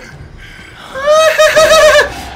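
high loud scream-laugh; shrieking panicked laugh. Real, not acted. Gamer startled by the monster, shrieking laugh. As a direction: burst into a terrified laughing shriek.

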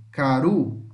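caru with uru said stronger and slow; teacher modelling caru for pupils; stress on the last syllable. Shown as a demonstration. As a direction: lean on the end of caru. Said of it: "o uru é mais forte".

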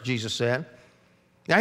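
usual speaking voice

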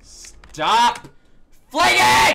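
loud high shout; real angry shouting; frustrated yell. Real, not acted. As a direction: yell at the game to stop. Yelling at game physics.

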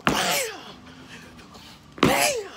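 shouted high bam; excited shout acting the hit. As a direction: punch out the impact sound. Acting out smashing the snake.

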